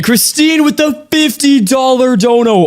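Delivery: excited. Real, not acted. Big donation announced.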